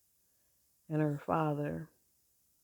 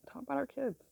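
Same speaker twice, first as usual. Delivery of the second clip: a raised, lingering repeat of the phrase; higher, wistful echo; 9 semitones higher, 3 dB quieter than usual. Real, not acted. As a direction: repeat it much higher and softer. Repeats phrase, second time higher.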